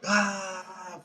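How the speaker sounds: high retold scream of pain. Injured friend screams as they lift him, high pained scream. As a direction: cry out like a broken leg. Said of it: "fucking screaming".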